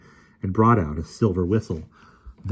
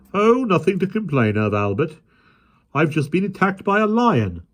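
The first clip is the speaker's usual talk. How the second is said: policeman voice; 11 semitones higher, 5 dB louder than usual. A narrator's reading.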